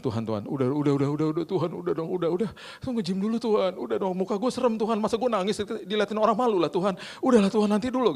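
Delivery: climbing into a high, whiny plea; pleading. Retold or imitated. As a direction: re-enact begging through embarrassed tears. Begs God while crying in gym.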